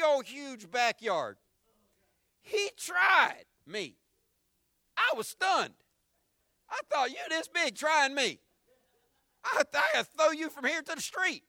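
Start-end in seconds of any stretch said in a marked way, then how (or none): 2.4-11.4 high, loud disbelief at being tried; preacher hamming up his shock, high and loud; incredulous outrage. Retold or imitated. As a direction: act stunned that he dared. Retelling son's defiance loudly.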